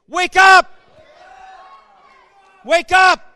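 high, loud call to wake; rally the congregation; rousing shout. Acted. Preacher leading the church in a loud rallying shout. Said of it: "Can you say it with me?".

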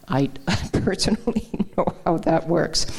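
personal admission broken by a chuckle; laughing while talking. Real, not acted. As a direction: laugh through the words as you admit it. Wry admission amid talk of suffering.

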